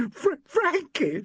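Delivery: high, slow, broken sob on the name; a young woman sobbing over her dead lover. A narrator's reading. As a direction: stammer the name through tears. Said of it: "she sobbed".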